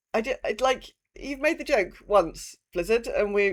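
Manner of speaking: usual speaking voice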